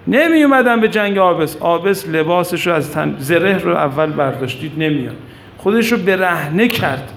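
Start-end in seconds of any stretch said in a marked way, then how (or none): none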